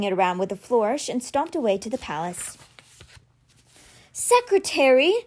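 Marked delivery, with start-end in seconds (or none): 4.1-5.1 high, drawn-out call with rising end; yelled summons. A narrator's reading. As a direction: holler for an underling down the hall. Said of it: "he yelled".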